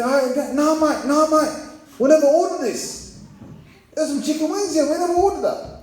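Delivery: high-pitched English lad protest; imitated English lads. Two English customers to the server about wings, imitated lads' protest. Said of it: "they're proper English".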